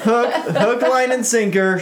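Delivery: high, laughing hook line and sinker; sing-song it through laughter; playful laughing tease. Real, not acted. Joking along.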